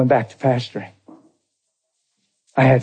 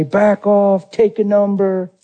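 imitated yelling; 6 semitones higher, slower than usual. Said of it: "They're yelling at everybody".

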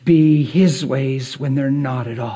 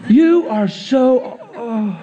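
mock gushing praise; 8 semitones higher, slower than usual. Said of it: "And you say".

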